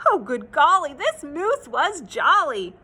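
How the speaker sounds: ordinary delivery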